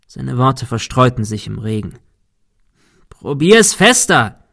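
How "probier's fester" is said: slow, high, loud shout. A narrator's reading. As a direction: yell the order through the storm. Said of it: "schrie Thomas". (laut und langsam geschrien)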